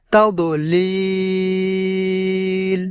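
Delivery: তাদলিল with the final লি held long; long vowel drawn out. Shown as a demonstration. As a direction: stretch the লি in তাদলিল slowly. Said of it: "এইভাবে টান দেব". (তাদলিল, শেষে লম্বা টান)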